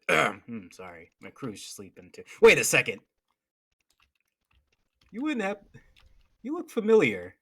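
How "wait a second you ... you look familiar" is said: sudden recognition. Acted.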